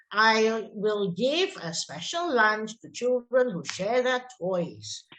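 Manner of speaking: slow, measured offer; positive instruction. Shown as a demonstration. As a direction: patiently lay out a reward. You can change it to.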